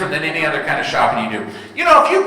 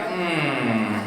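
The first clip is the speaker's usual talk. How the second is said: low reluctant hum; 6 semitones lower, 9 dB quieter than usual. Retold or imitated. Dad's reluctant reply to toddler.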